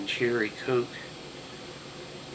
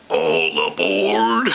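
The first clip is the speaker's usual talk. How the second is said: low conductor call; 6 semitones lower, 8 dB louder, slower than usual. Acted. Train conductor calling out.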